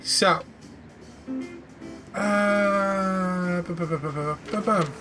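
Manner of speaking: ordinary delivery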